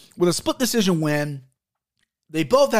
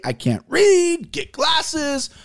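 self-mocking jab; 10 semitones higher, much slower than usual. Mocking himself for misreading.

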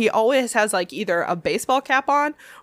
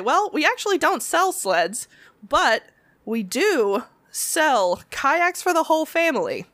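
bright, upbeat sales pitch, a touch higher; customer service cheer; 7 semitones higher than usual. Retold or imitated. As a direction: sell the kayak like a chirpy clerk.

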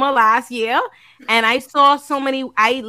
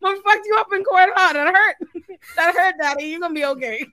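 teasing fake comfort, pitched up high; mock consoling; 7 semitones higher than usual. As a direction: pat him on the head, mockingly. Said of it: "I say".

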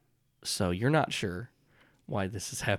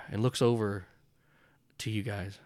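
ordinary delivery

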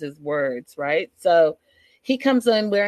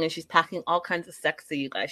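usual speaking voice

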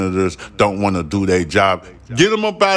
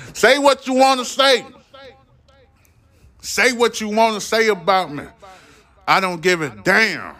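defiant shout; 10 semitones higher than usual. Real, not acted. Host daring his critics, a high defiant shout. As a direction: throw it at the critics.

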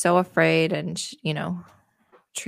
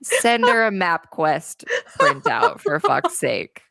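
laughing exasperation; 5 semitones higher, 4 dB louder, slower than usual. Real, not acted.

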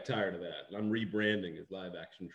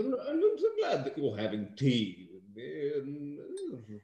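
put-on British accent; 10 semitones higher, 7 dB louder than usual. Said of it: "forced American accent".

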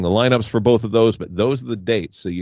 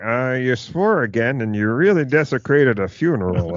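prim complaining listener; 4 semitones higher than usual. Retold or imitated. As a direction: read it like a fussy, offended letter writer. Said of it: "the email you're about to get from that listener".

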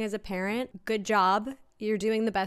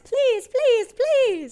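higher, louder pleading please; mock begging; 12 semitones higher, 9 dB louder, much slower than usual. Hosts asking for five-star reviews, hammed-up begging. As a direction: whine it like a begging kid.

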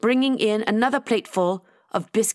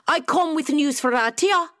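higher-pitched Scottish declaration; Scottish accent; 7 semitones higher than usual. A narrator's reading. Nessie announcing news at the door, Scottish accent. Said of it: "a familiar Scottish accent declared".

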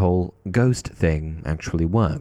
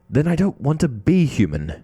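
narrator's mild roar, higher in pitch; understated roar; 8 semitones higher than usual. A narrator's reading. Harry refusing to be human, mildly roared. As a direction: raise the pitch for a contained, read-aloud roar. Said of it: "Harry roared".

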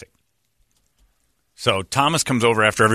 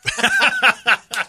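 high laugh; 13 semitones higher than usual. Real, not acted. Laughing at own story.

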